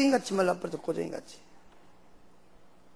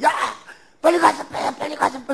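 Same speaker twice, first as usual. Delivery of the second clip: high, urgent, half-laughing plea; frantic mother; 6 semitones higher than usual. Retold or imitated. Mother jumping about begging son to grab the knife, frantic. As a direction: hop with panic, hurry him. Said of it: "어머니는 막 방방방방 뛰면서".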